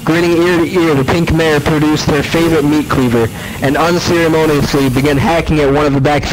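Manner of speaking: ordinary delivery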